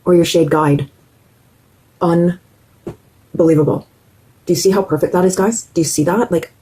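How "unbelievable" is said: usual speaking voice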